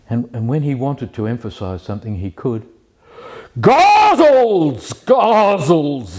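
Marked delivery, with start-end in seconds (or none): 3.6-6.2 loud, high, drawn-out bellow of one word; imitated shout. Imitating a man correcting me on Hafiz's ghazals, emphatic shout. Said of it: "he shouted and shouted at the same word".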